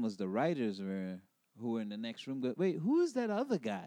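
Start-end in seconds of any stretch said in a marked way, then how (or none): 2.6-3.9 high-pitched, intrigued writer's voice; surprised question. Retold or imitated. Writers in the next room notice the reader, surprised. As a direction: perk up and demand to know who that is.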